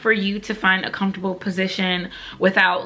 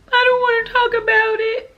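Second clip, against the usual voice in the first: high, loud tearful wail; mock ugly crying; 14 semitones higher, 5 dB louder than usual. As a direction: wail it through hammed-up sobs. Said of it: "as I was ugly crying".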